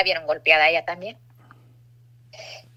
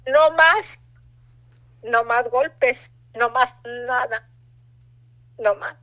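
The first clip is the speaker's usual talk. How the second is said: forcing out the words through tears; real tearful resolve, drawn out; tearful vow; 3 semitones higher, much slower than usual. Real, not acted. Vow after son's plea, emotional.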